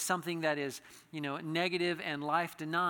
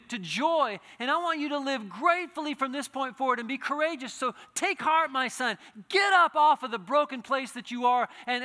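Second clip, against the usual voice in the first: louder and higher fervent preaching, Jesus speaking to the man; impassioned preaching; 8 semitones higher, 11 dB louder than usual. Acted. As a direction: pour out the call with rising fervour. Preacher voices Jesus and exhorts.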